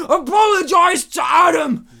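furious drawn-out bellow; loud, hammed-up angry shout; Kevin James. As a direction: roar it like an enforcer. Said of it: "It's like".

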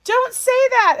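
high and loud, insisting; forceful. Retold or imitated. Hairdresser forcefully reassuring the little girl, high and loud. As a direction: push it high and loud like you won't be argued with. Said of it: "she was very forceful".

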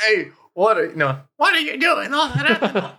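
very high mock mom in the background; hillbilly mom. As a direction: nag in a squeaky, put-on mom falsetto. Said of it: "You hear the mom in the background".

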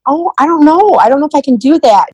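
high, hesitant protest; retold reluctance. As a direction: pitch it well up, unsure you can face it. Retold reluctance to tell dad.

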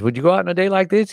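eager question. Retold or imitated. Asking the old sailor.

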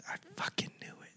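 whispered aside to oneself; hushed, whispering under the breath; whispered reaction. Retold or imitated. Retelling own muttered reaction.

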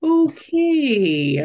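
disbelieving okay; stunned okay. Retold or imitated. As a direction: let the okay fly up in shock. Stunned reply to insult.

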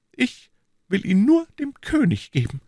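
high, proud queenly voice; a queen refusing with dignity; queen's voice. A narrator's reading. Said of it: "erwiderte Anna".

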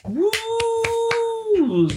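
high whooping cheer; excited cheer. Real, not acted. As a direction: whoop it up for the start. Cheering the show's opening.

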